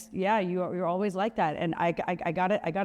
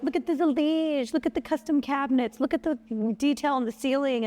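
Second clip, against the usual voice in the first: showing off; 7 semitones higher than usual. Retold or imitated. Imitating herself showing off house.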